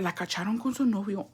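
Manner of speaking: softly retold gossip in Spanish; hushed gossip. Girl tells neighbour about the boyfriend, hushed gossip.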